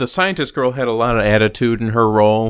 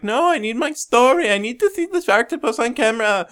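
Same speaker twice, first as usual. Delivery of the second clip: high, fast, stubborn mock reporter; insistent reporter; 12 semitones higher than usual. Podcaster mimicking the stubborn reporter, high and fast. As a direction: squeak out a silly refusal. Retold reporter refusing to leave.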